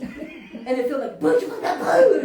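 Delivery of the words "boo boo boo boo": loud explosion noises, laughing; mimicked bomb blasts. Her swearing felt like atomic bombs, loud mock explosions. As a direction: build to one big high boom. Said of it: "she pressed all the atomic bombs".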